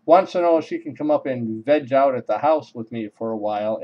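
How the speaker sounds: ordinary delivery, nothing marked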